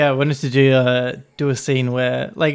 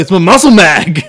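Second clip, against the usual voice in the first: loud, higher casual reply; mock innocent roommate; 4 semitones higher, 10 dB louder than usual. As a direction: play it totally unbothered. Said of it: "She's like".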